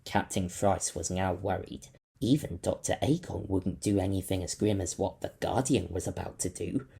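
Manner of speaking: ordinary delivery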